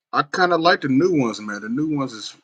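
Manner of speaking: light up at the new jerseys; excited. Real, not acted. Gamer browsing jerseys, excited about the new ones.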